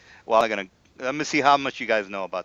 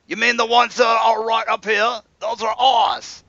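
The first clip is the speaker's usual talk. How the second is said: indignant guard; 8 semitones higher, 3 dB louder than usual. Acted. Guard shouts at the party that the buckets are theirs. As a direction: snap back that they're ours.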